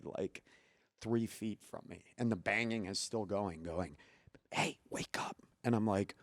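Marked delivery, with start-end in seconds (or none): 4.5-5.4 whispered wake-up call; imitated urgent whisper. Wife waking her sleeping husband at night, imitated whisper.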